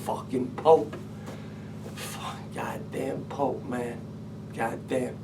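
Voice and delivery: slow, whispered grumbling curses; bitter muttered curse. Retold or imitated. Man who lost his wallet, blaming the Pope in a whisper. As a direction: hiss the curses under your breath, slowly. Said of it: "my first thought's gonna be".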